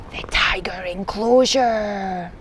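slow, drawn-out whisper; hushed suspense. A narrator's reading. Narrator reveals where Izzy went, whispering. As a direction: whisper it like forbidden danger.

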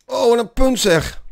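high, loud burst of delight at the rally; excited cheer. Real, not acted. Gamer cheering a great rally, excited and loud.

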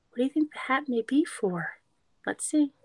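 lively storybook read-aloud; reading to children. A narrator's reading. Teacher reading a picture book to kids, lively read-aloud.

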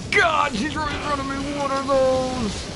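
high, loud drawn-out frightened yelling; real frightened high yelling; panicked disbelief. Real, not acted. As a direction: shriek that it just won't die. Monster won't die.

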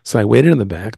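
usual speaking voice

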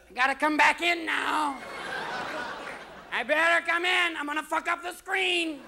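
high cat threat; pushy cat. Acted. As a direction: demand it like a cat at the screen. Cat demanding back in.

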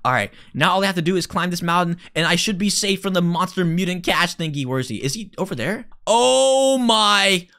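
ordinary delivery

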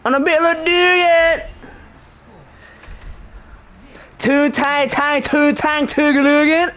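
high-pitched, exaggerated Baltimore accent; Baltimorean accent. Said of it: "I turned Baltimorean. I didn't mean to".

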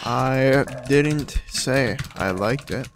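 drawn out word by word, as if typing; slow dictation. Real, not acted. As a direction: spell it out slowly while typing. Reads aloud what he types.

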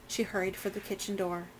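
ordinary delivery

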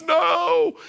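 high, hammed-up wail; sobbing little girl. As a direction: cry it out like a distraught child. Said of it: "the girl was crying".

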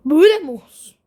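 high, loud indignant question; frustrated outburst. Real, not acted. Gamer arguing with the game, loud indignant cry. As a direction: fling it at the screen.

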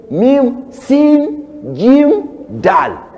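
"mim, sin, jim" each high and loud, "dal" falling; spelling out letters. Shown as a demonstration.

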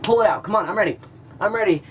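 high, loud goading; eager mock taunt. Teen on hands and knees taunting the bully, over-eager.

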